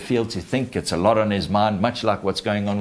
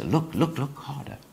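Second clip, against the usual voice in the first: hushed, higher-pitched urging; monkey's urging; 6 semitones higher, 5 dB quieter than usual. Acted. Monkey urges Simba to look in the water, hushed. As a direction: urge softly, repeating with growing hush. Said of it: "And the monkey says".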